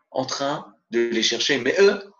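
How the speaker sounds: ordinary delivery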